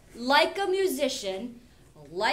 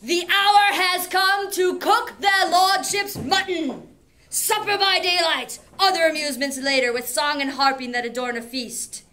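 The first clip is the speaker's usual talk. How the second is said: menacing Odysseus; 4 semitones higher, 8 dB louder than usual. Acted. Teacher relishes Odysseus announcing the slaughter to his son. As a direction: savor the threat like a hero about to strike. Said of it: "This is my favorite line".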